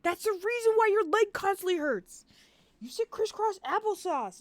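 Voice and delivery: high, hammed-up scolding impression; exasperated partner. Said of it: "He's like".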